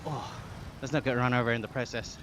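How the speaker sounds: ordinary talk, nothing marked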